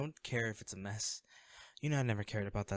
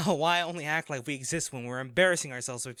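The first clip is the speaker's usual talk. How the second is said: frustrated outburst; 5 semitones higher, 7 dB louder than usual. Acted. To an old friend, venting about fake concern, rising to a shout. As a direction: let the frustration boil over.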